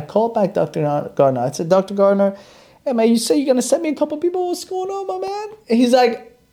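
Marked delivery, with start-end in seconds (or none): 1.7-5.6 forced casual. Retold or imitated. Calls doctor, friendly complaint.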